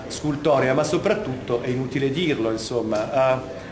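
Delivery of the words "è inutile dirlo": ordinary delivery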